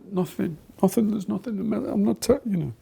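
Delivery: high, lightly voiced girl brushing it off; imitated upset girl. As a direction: play the tearful pupil insisting it's nothing. Upset girl denies anything wrong.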